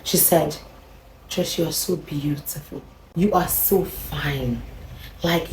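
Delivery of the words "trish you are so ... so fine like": soft, slow, honeyed compliments; soft sultry flattery.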